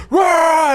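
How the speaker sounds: high, loud "rah"; short acted war cry; pig man's roar. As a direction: burst into a beastly roar. Pig dude roars back.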